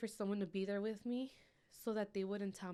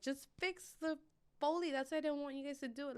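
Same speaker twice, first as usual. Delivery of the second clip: furious demand; 6 semitones higher than usual. Retold or imitated. Mother scolding the hospital girl, high angry outburst. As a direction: blame them for it. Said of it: "screaming at the girl".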